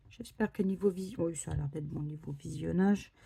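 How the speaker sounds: normal talking voice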